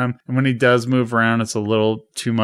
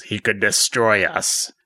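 slow, lightly imitated villain ruler; slow down for a hint of a menacing master; Emperor. Emperor warning Vader, slow light impression. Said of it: "And he says".

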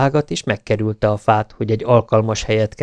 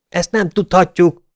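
high, raised-voice outburst; shouting; 8 semitones higher, 4 dB louder than usual. A narrator's reading. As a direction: shout it in angry denial. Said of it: "ordította Wyatt". (ordítva, magas hangon)

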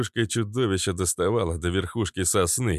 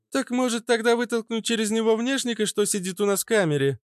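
put-on much higher, lively young girl; eager girl voice. A narrator's reading. Narrator voices redhead Fitilyok.

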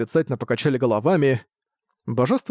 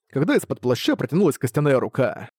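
plain reading voice

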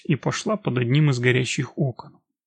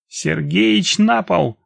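higher, raised urgent cry; shouted command; 5 semitones higher, 5 dB louder, much slower than usual. A narrator's reading. Woman bursts into the room, shouting to the old man. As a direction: call out a warning, louder and pitched up. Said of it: "выкрикнула я".

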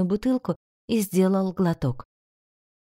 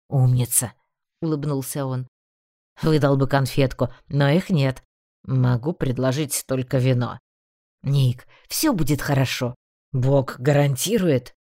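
lightly lowered playful male voice; teasing young man; 6 semitones lower than usual. A narrator's reading. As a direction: tease her kindly in a lower voice. Bran's voice, lower.

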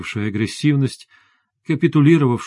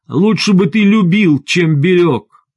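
loud, high cry after him; wife's raised, drawn-out outcry at the end; shouted reproach; 5 semitones higher, 5 dB louder, slower than usual. A narrator's reading. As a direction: hurl the reproach down the stairwell. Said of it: "его догнал выкрик жены".

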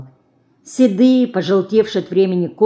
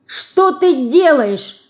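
indignant, higher drawn-out outburst; возмущённо; 9 semitones higher, 4 dB louder, much slower than usual. A narrator's reading. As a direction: cry it out in slow, high indignation. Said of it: "возмутилась Динка".

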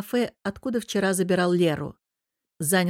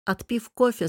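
ordinary delivery, nothing marked

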